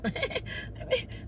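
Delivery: a high, breathless wheeze of laughter; high breathy laugh. Retold or imitated. Laughing at the bougie man, high breathy giggle. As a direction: laugh high through the air.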